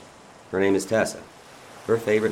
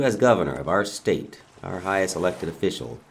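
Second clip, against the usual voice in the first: ordinary delivery, nothing marked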